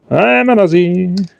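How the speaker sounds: hammed-up Australian declaration; exaggerated Aussie accent. Shown as a demonstration. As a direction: lay the Aussie accent on thick. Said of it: "put this accent on any better".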